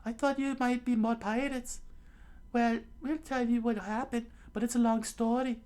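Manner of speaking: put-on very high falsetto girl's voice; Nami, relieved and friendly. Plays Nami in the story.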